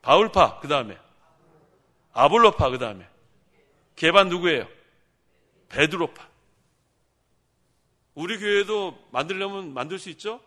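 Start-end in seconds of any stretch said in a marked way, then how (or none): 0.0-6.3 loud, emphatic calling out of each faction; preaching shout. Acted. Pastor calling out Corinth factions to the congregation, loud and emphatic. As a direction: call each faction name out to the hall.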